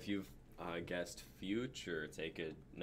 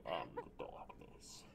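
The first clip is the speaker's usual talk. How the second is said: low, breathy growl of darkness; lightly voiced Batman villain; mock brooding villain; dark villain voice; 7 semitones lower, 7 dB quieter than usual. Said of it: "Bane's voice".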